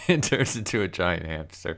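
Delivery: laughing through the words; amused, words breaking into laughter. Real, not acted. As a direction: crack up while telling it. Cracks up retelling the hamster gag.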